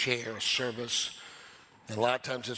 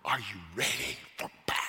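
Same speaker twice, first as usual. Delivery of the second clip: low, measured battle question; low military challenge; commander's aide addressing troops; 3 semitones lower than usual. Acted. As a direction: ask the army with grave weight. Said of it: "asked the whole army".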